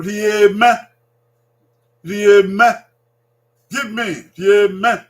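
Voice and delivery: Rieme called out loud with a lift at the end; loud drill. Shown as a demonstration. Teacher calling Rieme out loud for children to repeat. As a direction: call the word out clearly to be copied. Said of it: "teacher models Edo word loudly".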